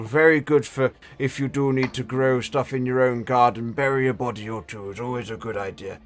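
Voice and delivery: put-on high, slightly slow old man. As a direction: play a doddery old wizard. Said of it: "Generic old man voice one".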